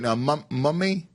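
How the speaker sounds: normal talking voice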